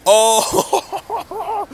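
sudden high, loud "Oh!" of delight; excited shout. Real, not acted. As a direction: burst out with joy at the surprise. Sees friend's team arrive.

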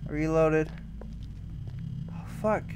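normal talking voice

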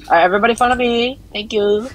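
fast, high put-on leader voice; funny put-on voice. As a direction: rattle it off in a silly high voice. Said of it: "what do you sound like an Indian, like, toll god".

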